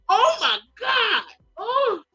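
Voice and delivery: slow, high crying shout; hammed-up sobbing outburst; mock crying wail. Shown as a demonstration. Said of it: "I read stuff how I feel it".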